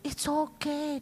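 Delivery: high, sweet, overly polite voice; polite reassurance. Retold or imitated. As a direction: gush politely to the late visitor. Pastor politely reassuring visitor.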